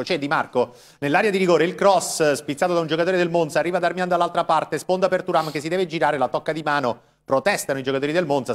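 fast, rising live play-by-play; breathless italian goal commentary. Real, not acted. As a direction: call the action as it happens.

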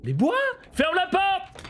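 high, loud frantic orders; urgent shouting. Real, not acted. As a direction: bark orders in a panic. Urgence, ordres criés. (ordres criés dans l'urgence)

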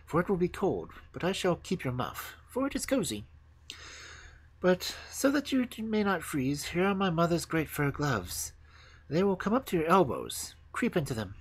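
put-on high, light girl's voice; high-pitched practical little robber; bossy robber girl. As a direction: hand out orders in a high voice.

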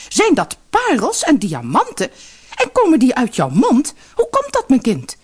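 high, excited string of amazed questions; astonished. A narrator's reading. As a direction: pitch the questions up in disbelief. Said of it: "riep haar moeder stom verbaasd uit".

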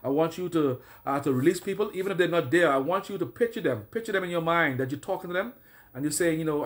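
normal talking voice

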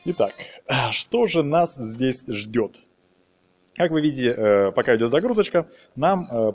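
normal talking voice

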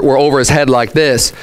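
normal talking voice

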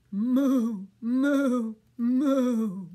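loud, drawn-out moos; steady, resonant cow calls; cow mooing. Acted. Voicing cows awaiting milking.

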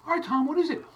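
lightly pressing Tom for the answer; growing impatience at the video; impatient. Retold or imitated. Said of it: "You go".

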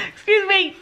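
laughing through the words. Pretend. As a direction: giggly excuse me. Repeated excuse me laughing.

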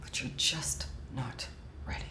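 soft unvoiced whisper of the bad news. Agent whispering the rejection to the actor. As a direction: breathe the verdict so only he hears. Agent lets him down gently.

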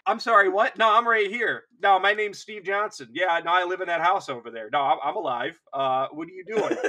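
loud, high, bewildered bystander; hammed-up confused civilian pleading his case; protesting survivor. Voicing a survivor overhearing the report.

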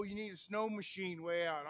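ordinary talk, nothing marked

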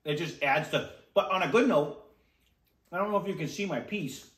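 talking while chewing; muffled food-filled speech; mouth full of pizza. Real, not acted. Seen 1: Man bites slice around 0.2-1.1 s, then keeps chewing near mouth.

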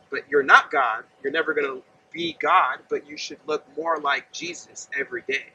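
ordinary talk

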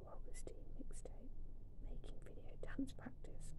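hushed, breathless whisper of delight. Real, not acted. Fan unboxing merch, whispering in awe. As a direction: whisper it like a secret treasure.

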